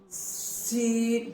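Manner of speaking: سین with a long whistling hiss on the s, drawn out slowly; سین said slowly with a sharp sibilant hiss; hissing seen. Shown as a demonstration. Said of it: "وہ دانتوں کے قریب لانا ہے".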